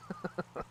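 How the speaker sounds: soft little laugh; brief quiet chuckle. Real, not acted. Streamer laughs mid-chat.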